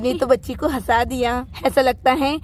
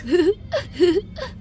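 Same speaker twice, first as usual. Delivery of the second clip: put-on baby crying; 4 semitones higher than usual.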